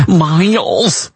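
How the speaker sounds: exaggerated mock Michigan accent on "Miles"; hammed-up Michigan "Miles". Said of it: "that's my Michigan accent".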